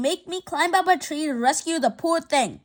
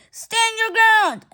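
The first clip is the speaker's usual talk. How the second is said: rallying shout; 5 semitones higher, 4 dB louder, slower than usual. Acted. Rallying kids against the bell.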